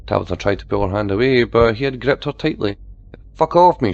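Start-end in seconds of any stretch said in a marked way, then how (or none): 3.3-3.9 loud, higher snarl. A narrator's reading. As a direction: spit it at him in fury. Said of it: "she snarled".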